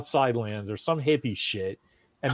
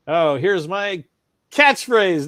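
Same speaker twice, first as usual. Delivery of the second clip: smug mockery; 5 semitones higher, 7 dB louder, slower than usual. Mocking smug sequel writers.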